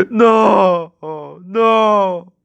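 high, loud crying "no"; anguished wail. Acted. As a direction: wail it in long, horrified cries. Suspect sees fiancée's severed head.

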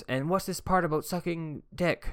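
hammed-up accusing teacher; mock teacher. Teacher asking about the crude line, mock stern. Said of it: "And she goes".

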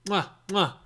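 quiet kissing sounds; chef's kiss. Shown as a demonstration. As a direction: kiss your fingers, mwah. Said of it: "I'm doing the chef kiss right now".

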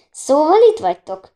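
loud, high accusing cry; exclaiming. A narrator's reading. Countess confronts the girls as they are leaving, loud exclamation. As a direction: call them out, voice jumping up. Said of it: "kiáltotta".

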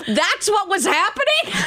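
imitating high, laughing disbelief; astonished husband. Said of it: "he damn near fell over".

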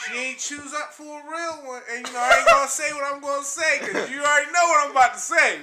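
high, loud and emphatic; fired-up declaration. Real, not acted. Storyteller declaring his known line to co-hosts, fired-up declaration.

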